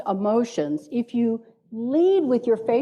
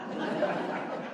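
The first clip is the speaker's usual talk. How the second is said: soft laugh; 11 dB quieter than usual. Real, not acted. Jokes about being boring.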